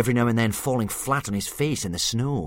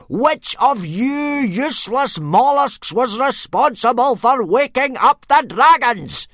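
much higher, much louder, slow furious roar; exploding rage; 14 semitones higher, 12 dB louder, slower than usual. A narrator's reading. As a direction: blow up at the useless recruits. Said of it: "he exploded all over the place".

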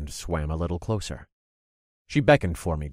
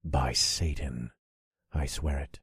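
hushed vow; 4 semitones lower, 6 dB quieter than usual. Acted. Whispered vow.

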